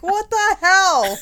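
high, slow shocked shriek; shrieking outrage. Retold or imitated. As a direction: shriek it out in disbelief. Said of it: "She's like".

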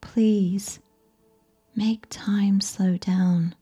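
ordinary delivery, nothing marked

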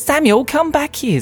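high, drawn-out sisterly call; calling after someone. Acted. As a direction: call him back urgently. Sister calls brother back.

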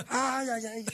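high, drawn-out wail; mock cry of pain. Player retelling the injury, mock pained wail. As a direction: imitate someone howling in pain. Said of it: "así gritando".

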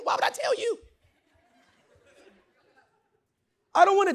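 high, loud, shouted sermon; fired-up preacher shouting; full-force preaching. Acted.

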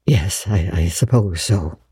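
low, hesitant agreement; reluctant. A narrator's reading. Matthew giving in to Marilla, reluctant. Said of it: "said Matthew reluctantly".

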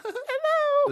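squeaky-high mock little girl; hammed-up falsetto child voice; young girl impression. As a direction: pitch it way up like a small girl. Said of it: "impression of a young girl".

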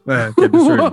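normal talking voice

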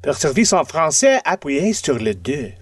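French recorded phone-menu voice. Retold or imitated. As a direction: read it like a bilingual hold message. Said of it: "That's what we get in our phone trays".

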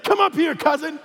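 high-pitched hollered summons; mock yell across the airport; imitated screaming. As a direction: call someone over from far away. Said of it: "he starts screaming over the whole airport".

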